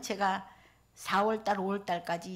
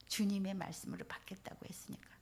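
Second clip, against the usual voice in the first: whispered; 8 dB quieter than usual. Shown as a demonstration. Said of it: "repeated after 예?".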